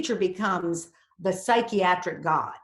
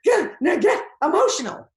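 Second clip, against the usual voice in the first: higher, louder, tight forcing; mock strained self-pep talk; tense straining; 8 semitones higher, 7 dB louder than usual. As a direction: grit it out like a tense actor trying to cry. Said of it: "fall into tension".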